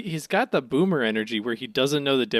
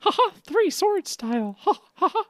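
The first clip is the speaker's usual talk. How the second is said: high cartoon voice. Zoro bit in high voice.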